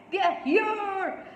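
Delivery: put-on high, ringing child's call; excited shout. A narrator's reading. Sanjay spotting the car outside, shouted excitedly. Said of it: "Sanjay shouted".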